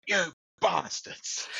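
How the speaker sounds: slow, drawn-out mock curse. Cursing laughing mates after the embarrassment, slow drawn-out. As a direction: groan it at the mates who set him up.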